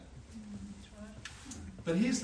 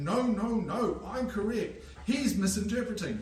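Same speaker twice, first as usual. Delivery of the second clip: mock lawyer protest; 4 semitones higher, 3 dB louder than usual. Lawyers arguing it out.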